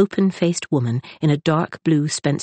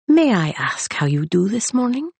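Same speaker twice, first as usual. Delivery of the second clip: higher, courteous foreign gentleman; a light lilt of politeness; polite librarian; 6 semitones higher than usual. A narrator's reading.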